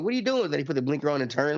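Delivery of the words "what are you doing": high, incredulous mock question; mock disbelief. Ranting about drivers who stop mid-road, high incredulous question. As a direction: ask it high, as if baffled. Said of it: "they stop, like".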